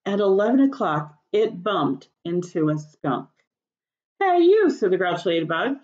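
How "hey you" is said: loud, high, challenging call. A narrator's reading. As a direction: yell it out to pick a fight. Grouchy ladybug calls out.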